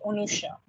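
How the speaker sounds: "Unusha" with a rounded first vowel; Bengali pronunciation. Shown as a demonstration. Teacher answering to the Bengali form, Bengali pronunciation. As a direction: start "Unusha" on o/u, not a. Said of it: "gets pronounced in Bengal".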